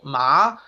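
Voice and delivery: "Ma" climbing like a question; rising second tone. Shown as a demonstration. Teacher showing learners the second tone, "Ma" rising. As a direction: lift the pitch through "Ma". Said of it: "It's the rising tone".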